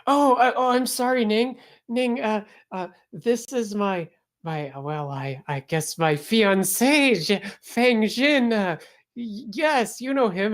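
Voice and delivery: put-on high, stammering flustered girl; embarrassed high voice; flustered Lena. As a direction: fumble the introduction. Lena flustered introducing fiancé.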